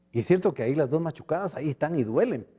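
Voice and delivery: usual speaking voice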